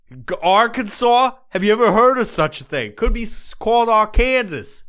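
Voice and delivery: high, loud disbelief at the name Arkansas; incredulous rant. Acted. Radio host ranting to listeners about Arkansas, loud and incredulous. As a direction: bellow it like the name is absurd.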